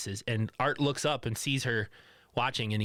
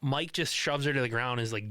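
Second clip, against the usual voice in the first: normal talking voice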